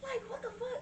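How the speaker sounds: high disbelieving what the fuck; incredulous. Real, not acted. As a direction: pitch up in disbelief. Incredulous outburst.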